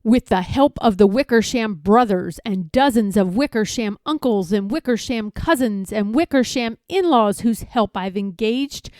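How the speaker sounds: plain reading voice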